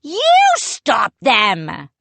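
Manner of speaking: slow, loud retort; the other head snapping back at his twin; shooting back. A narrator's reading. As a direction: throw it right back. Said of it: "Pepper shot back".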